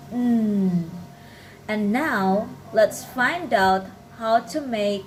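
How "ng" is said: N held as a steady "ng" hum; velar nasal sound. Shown as a demonstration. Teacher summing up the spelling rule, N hummed as "ng". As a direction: say N as "ng", tongue back, air through the nose. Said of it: "pronounce the N as".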